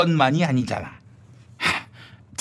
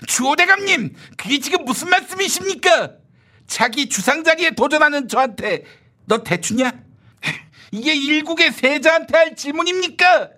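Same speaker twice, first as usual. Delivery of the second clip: much higher protesting voice; indignant young upstart; 9 semitones higher than usual. Acted. Upstart protesting to minister, higher indignant. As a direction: play the shocked junior crying foul.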